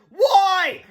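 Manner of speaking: high, loud drawn-out cry; despairing shout; wailing. A narrator's reading. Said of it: "cried Harold".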